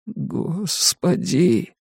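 high, slow, hushed plea; horrified plea. A narrator's reading. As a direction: stretch it out in dread. Victim's silent terrified plea. (протяжная мольба в ужасе)

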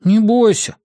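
put-on high boyish reassurance; Бенни. A narrator's reading. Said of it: "похлопал Нори по спине". (высокий мальчишеский голос, утешает)

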